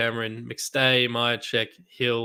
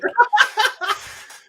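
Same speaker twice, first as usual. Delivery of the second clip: high laugh; 7 dB louder than usual. Real, not acted. Cracking up after the yell.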